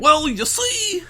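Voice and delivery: very high, slow, drawn-out impression; Bill Cosby. Podcast host attempts the requested impression briefly, hammed-up comedian voice. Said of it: "Do a Bill Cosby voice".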